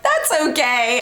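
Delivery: high, put-on polite brush-off; mock-polite refusal, pitched well up; petty polite refusal. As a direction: wriggle out of it in a high voice. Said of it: "Just so petty".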